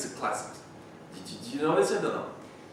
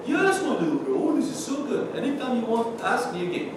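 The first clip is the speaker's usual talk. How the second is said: doting teacher; 7 semitones higher than usual. Retold or imitated. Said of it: "what do you tell them? Say".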